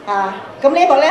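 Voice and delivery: ordinary talk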